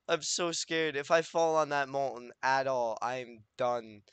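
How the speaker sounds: usual speaking voice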